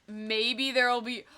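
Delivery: usual speaking voice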